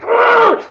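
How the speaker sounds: high, loud strangled "Argh!" of frustration; inner frustrated scream. Retold or imitated. Tired father at 2 a.m. with a screaming son, frustrated yell. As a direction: let out a sudden high yell of pent-up frustration. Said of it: "part of me inside is going like".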